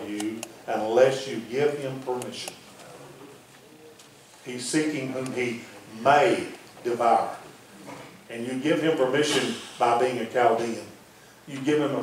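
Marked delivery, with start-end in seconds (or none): 4.4-7.3 slow, low delivery with MAY set apart and drawn out; pause, then lean slowly on "may"; stress on MAY. Shown as a demonstration. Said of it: "Place your emphasis on the word little".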